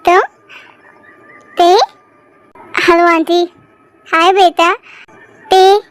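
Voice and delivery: ordinary delivery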